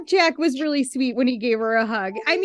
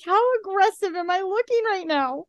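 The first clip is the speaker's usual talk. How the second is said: mock worried; 6 semitones higher than usual. Mock self-conscious worry.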